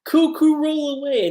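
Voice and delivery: high, loud sing-song villain taunt; cuckoo villain's line. Retold or imitated. Villain taunts Wendy while retelling the episode, high loud taunt. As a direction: squawk it out like a cocky bird. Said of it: "and he's like".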